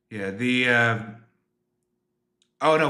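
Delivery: ordinary delivery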